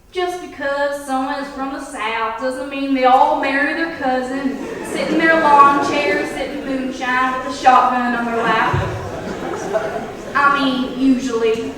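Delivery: Southern American drawl. Retold or imitated. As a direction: lay on a thick mock Southern American drawl. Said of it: "that typical Southern American drawl".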